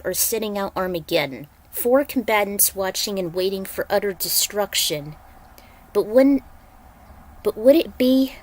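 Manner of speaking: ordinary delivery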